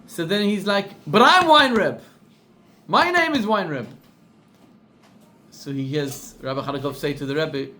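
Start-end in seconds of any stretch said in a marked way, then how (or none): 1.1-3.9 louder, higher burst of surprise; startled realization. Retold or imitated. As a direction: blurt it out, stunned. Caller realizes he is Weinreb.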